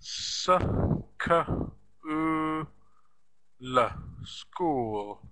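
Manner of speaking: s, k, u, l sounded out one by one; sounding out letters. Shown as a demonstration. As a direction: sound out each letter of school slowly. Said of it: "the sounds of the word".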